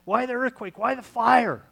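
loud, high preaching shout; booming cry. Acted. Preacher to congregation, crying out loud. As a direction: thunder the questions out to the crowd.

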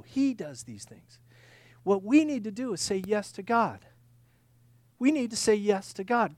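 ordinary talk

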